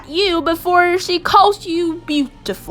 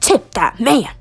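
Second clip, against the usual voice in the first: "Tip that man" said firmly in a lower register; low-pitched urging; 3 semitones lower than usual. A narrator's reading. Narrator urging you to pay the barber, low first take.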